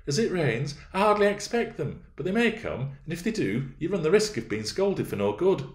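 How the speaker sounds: put-on high, light girlish voice; high-voiced young lady, reasoning; young Catherine. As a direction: pitch it well up for a young miss making excuses. Narrator voices Catherine making excuses.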